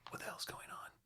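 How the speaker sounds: very soft, breath-only whisper; secretive, unvoiced aside; whispered in the ear. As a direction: hiss it privately into someone's ear. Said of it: "Red says in Jennifer's ear".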